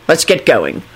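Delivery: low, firm instruction; decisive. A narrator's reading. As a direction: say it as a settled decision. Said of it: "Kingsley said decisively".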